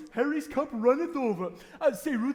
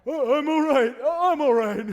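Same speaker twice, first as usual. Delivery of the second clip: put-on drunken reassurance; 3 semitones higher, 7 dB louder than usual. Drunk uncle staggering home.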